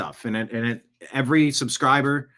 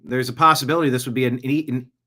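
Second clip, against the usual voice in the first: normal talking voice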